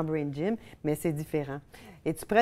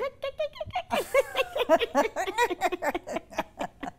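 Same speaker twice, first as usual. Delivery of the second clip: laughing on Y. Shown as a demonstration. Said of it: "on a fait Y".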